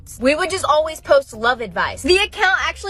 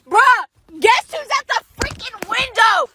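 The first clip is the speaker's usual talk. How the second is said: higher and louder, almost shouting; excited outburst; 8 semitones higher, 4 dB louder than usual. Real, not acted. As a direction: burst out with the news. Excited reveal to viewers.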